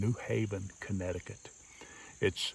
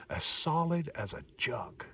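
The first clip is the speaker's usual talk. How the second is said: put-on light old-timer voice, a touch higher and softer; old-timer saying; 6 semitones higher, 4 dB quieter than usual. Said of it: "as the old timers used to say".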